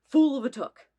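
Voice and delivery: loud movie quote yell; mock quote. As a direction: bellow the famous line.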